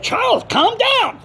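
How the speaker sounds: high-pitched retold plea to calm down; imitated pacifying caller. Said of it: "He said".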